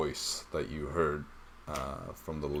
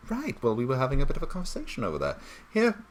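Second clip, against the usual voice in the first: high, fast, put-on character voice; 12 semitones higher, 5 dB louder, faster than usual. NPC hands the book to the player, brisk and high. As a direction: hand over the book in a quick high voice.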